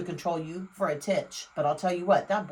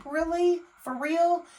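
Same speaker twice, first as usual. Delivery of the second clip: sarcastic disbelief; 9 semitones higher, slower than usual. Real, not acted.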